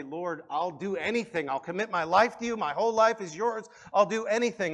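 retold desperate plea, rising high and loud; crying out to God. Mother pleads to God over her lifeless daughter, crying out. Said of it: "began to cry out to God".

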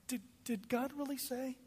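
soft, high, insinuating question; slithering Satan. Acted. Satan in the garden sowing doubt, slithering and hushed. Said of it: "Couldn't you see Satan slithering up".

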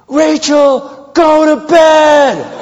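high, loud, slow yelling dad; mock angry dad shout. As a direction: bellow it like a fed-up father. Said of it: "now guess who I am".

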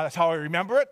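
plain reading voice

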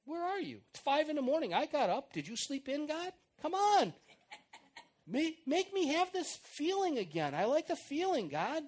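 mock whining at God; whining complaint. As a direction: complain to God like a spoiled child. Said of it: "whining and complaining".

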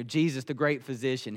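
usual speaking voice

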